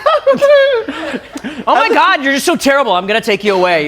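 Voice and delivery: put-on high, loud squealing Valley Girl; bubbly sorority girl. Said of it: "Valley Girl version of the Krampus".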